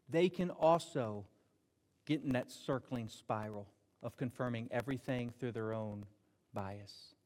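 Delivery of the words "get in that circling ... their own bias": sinking quiet. Real, not acted. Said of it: "trails to near whisper".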